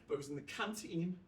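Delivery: normal talking voice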